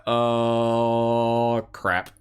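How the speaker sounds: long drawn-out "Oh", then a dropped "crap"; dreading groan. Retold or imitated. Reacting to relatives coming over, dreading groan. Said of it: "it's just like".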